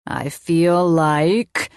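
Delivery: very slow, drawn-out mock menace; funny villain voice. A narrator's reading. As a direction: stretch it like a play mad scientist. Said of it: "Colin said in a funny voice".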